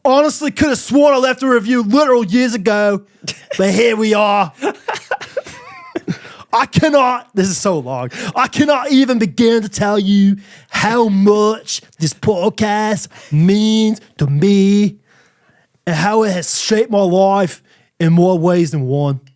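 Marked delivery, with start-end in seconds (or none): 0.0-7.3 higher, hammed-up Australian-London accent; bad Australian accent. Podcaster reading a fan's review in a bad Australian accent. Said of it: "it's a really good Australian accent". 8.4-19.2 hammed-up Australian accent, stretching key words; bad Australian accent. Podcaster reading a fan's review in a bad Australian accent. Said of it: "it's a really good Australian accent".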